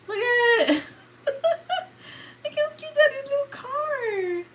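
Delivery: high, slow, laughing squeal over something cute; squealing with delight. Real, not acted. Gushing to viewers over a cute little toy car, high laughing squeal.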